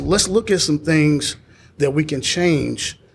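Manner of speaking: normal talking voice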